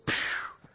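unvoiced puff of air like a whoosh; imitated whoosh. Shown as a demonstration. Storyteller describing waiters' service suddenly improving, imitated whoosh. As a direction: blow out a quick "pshew" sound effect.

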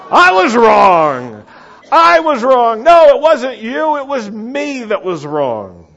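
high, very loud confession; enthusiastic shout. Shown as a demonstration. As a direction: cry it out with over-the-top zeal. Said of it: "Can you say it with some enthusiasm?".

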